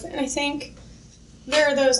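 ordinary talk, nothing marked

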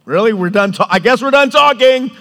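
loud, indignant build to a high yell; fighting spouse. Acted. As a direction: storm out of a fight, yelling. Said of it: "with a fight with your spouse".

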